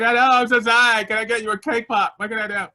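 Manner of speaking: high, loud imitation of a tearful wail; mock crying. Crying with the homeless woman outside, mock-sobbing apology. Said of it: "I'm crying and we're just crying".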